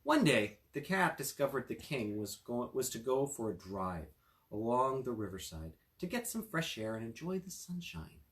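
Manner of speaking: usual speaking voice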